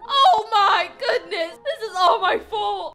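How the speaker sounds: loud, high alarm, a bit teary; shouting in guilty dismay; freaking out. Acted. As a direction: panic and guilt spilling out. Guilty panic over sick guests.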